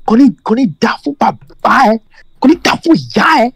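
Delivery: high, heated Yoruba insult. Retold or imitated. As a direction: rant in Yoruba, pitch up high.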